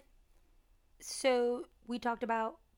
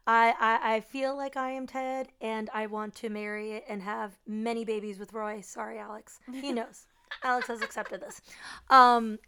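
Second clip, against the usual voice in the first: ordinary talk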